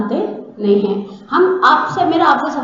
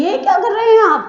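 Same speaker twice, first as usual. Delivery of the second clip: raised alarmed voice; 7 semitones higher than usual. Retold or imitated. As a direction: pitch jumps high on یہ کیا کر رہے ہیں آپ؟ in protest. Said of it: "حضرت موسیٰ کی آواز کھڑی ہو گئی". (حیرت سے اونچی آواز میں)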